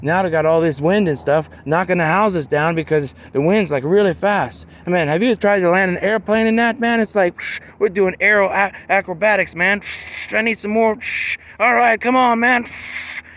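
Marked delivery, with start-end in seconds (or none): 4.8-13.2 strained cockpit chatter building up; hammed-up bumpy landing. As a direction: play a pilot fighting the wind, getting more worked up. Mock turbulent landing in wind.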